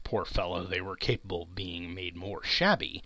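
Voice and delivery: ordinary delivery, nothing marked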